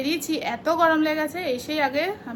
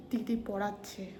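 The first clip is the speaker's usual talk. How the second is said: soft, low-key voice so as not to disturb; talking quietly; 4 semitones lower, 9 dB quieter than usual. Real, not acted. Quietly explaining to viewers while sister teaches. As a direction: keep it down, someone is teaching nearby. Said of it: "তাই আমি আস্তে কথা বলছি".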